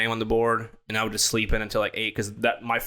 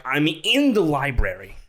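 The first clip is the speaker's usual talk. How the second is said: slow exasperated insistence, leaning hard on "in"; insistent protest; 4 semitones higher, 3 dB louder, slower than usual. Retold or imitated. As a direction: stress on "in", raised higher and drawn out. Retold argument, insisting to father.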